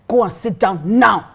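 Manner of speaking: slow, emphatic command; retold stern order. Pastor ordering the drunk guy to sit, imitated stern command. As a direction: order it slowly, landing hard on "now.".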